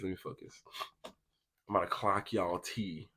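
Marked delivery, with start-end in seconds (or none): 0.0-0.5 hushed self-reminder to concentrate; whispered to self. Real, not acted. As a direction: whisper "Let me focus." to yourself.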